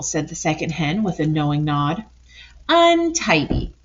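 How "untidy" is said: high, slow and loud tut; disapproving hen. Acted. Second hen scolding the pigs, high and drawn out. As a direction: stretch it out in prim outrage.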